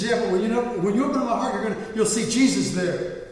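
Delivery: retold boy insisting; insistent child. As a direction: cut in eagerly as the little boy. Boy insists, interrupting the surgeon.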